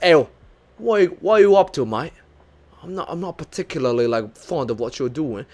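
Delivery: badly faked Liverpool English accent; butchered Liverpool accent. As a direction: put on a botched Liverpool accent. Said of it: "like a Liverpool accent".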